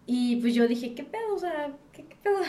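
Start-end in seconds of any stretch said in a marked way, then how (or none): 0.9-2.4 high, startled, retold alarm. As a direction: jolt awake confused and alarmed.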